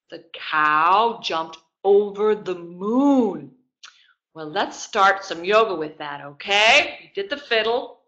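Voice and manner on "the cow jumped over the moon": slow, stretched sing-song; drawn-out rhyme line. Shown as a demonstration. Teacher drawing out the rhyme line for kids. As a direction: draw the line out slowly. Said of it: "And then what happens next?".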